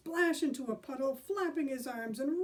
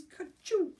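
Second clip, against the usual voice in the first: mock sneeze; 9 semitones higher, 5 dB louder than usual. Imitating a sneeze.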